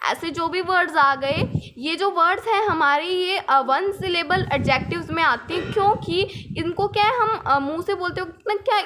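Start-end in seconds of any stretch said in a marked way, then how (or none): none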